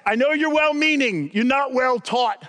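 mock yelling at TV. Pastor yelling at a TV athlete, mock yell. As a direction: yell at the TV, half in fun. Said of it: "I yell at my T V every time".

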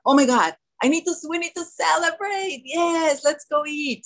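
"crux" no, high, giddy "Oh my God, let's go eat"; mock-thrilled at the chance to celebrate with food; excited inner voice. Speaker mimics her own craving thoughts, high and giddy.

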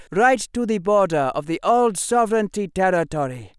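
loud, high-pitched commanding officer; commander addressing troops. Acted. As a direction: bark orders to the ranks.

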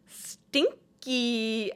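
drawn-out mimicry of a talking fart; squeaker fart voice. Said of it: "a squeaker fart if it could talk".

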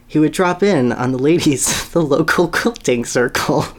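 breaking into laughter mid-sentence; reading a funny line to his co-host and cracking up; laughing while reading. Real, not acted. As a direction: try to read through your own giggles.

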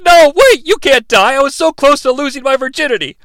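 mock crybaby Sokka begging her; whiny baby voice. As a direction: plead like a panicked baby. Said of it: "Sokka's a baby".

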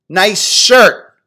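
high, loud mocking yell. Philly guys jeering at the pink shirt, loud mock yell. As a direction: jeer it from a passing car. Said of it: "instantly yelled out".

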